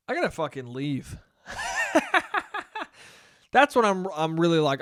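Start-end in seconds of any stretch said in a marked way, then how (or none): 1.5-2.8 sudden high burst of laughter. Real, not acted. Laughing at his own joke about leaving. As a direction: laugh high and breathy at the thought.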